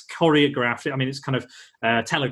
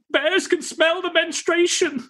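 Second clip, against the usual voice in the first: high, slow mock newsman quote; a hammed-up movie line, pitched way up; imitating Anchorman line; slower than usual. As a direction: squeak it out high and drawn out like the film. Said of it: "'cause it's going".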